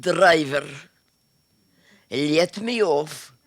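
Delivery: put-on mother calling the driver in a Russian accent. Mother on a bus asks the driver to stop, Russian accent.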